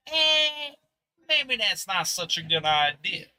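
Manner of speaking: slow, drawn-out New Yorker accent voicing her thoughts. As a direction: play the player as a doubtful New Yorker. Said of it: "a New Yorker accent".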